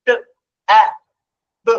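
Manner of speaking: "ট", "আ", "ব" as separate short sounds with pauses; sounding out. Shown as a demonstration. Teacher showing how a child decodes tab, sounding out "ট, আ, ব". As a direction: sound out each letter of tab: "ট, আ, ব". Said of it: "যদি সে sound করে পড়ে".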